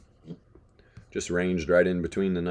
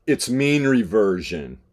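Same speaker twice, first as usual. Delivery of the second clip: mock condescension; 5 semitones higher, 10 dB louder, much slower than usual. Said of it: "he's like".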